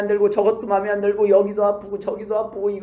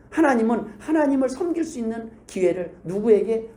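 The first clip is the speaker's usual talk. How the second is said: normal talking voice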